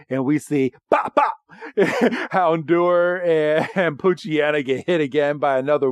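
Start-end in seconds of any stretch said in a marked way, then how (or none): 0.9-1.3 two high, popping sound effects; imitated hit noises. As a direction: mimic quick cartoon impacts. Imitates water shots hitting.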